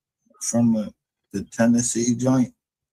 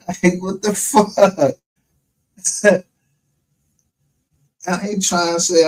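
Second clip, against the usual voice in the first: ordinary talk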